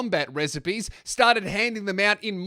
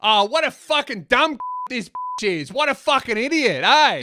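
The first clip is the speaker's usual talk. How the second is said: high-pitched, exasperated Australian outburst; mock outraged Aussie; 7 semitones higher, 5 dB louder than usual. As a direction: play the incredulous Aussie letting rip. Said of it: "became a little bit more Australian".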